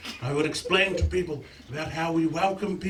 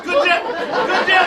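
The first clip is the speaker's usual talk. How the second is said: high, loud challenge; 10 semitones higher, 5 dB louder than usual. Real, not acted. Pressing the audience.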